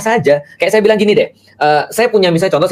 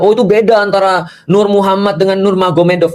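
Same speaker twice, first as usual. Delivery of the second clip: mock objection; 4 semitones higher than usual. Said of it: "Kan kita tidak mengatakan tadi".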